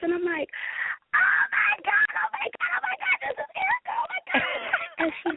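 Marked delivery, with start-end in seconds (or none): 1.1-4.4 very high-pitched squeal of disbelief; squealing, breathlessly repeated fan freak-out; imitated excited screaming. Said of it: "I'm just screaming".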